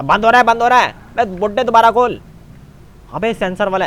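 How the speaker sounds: loud, high cry of alarm; urgent shout. Pretend. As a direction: shout to get the door reopened. Garage door closing on him. (घबराकर ज़ोर से चिल्लाना)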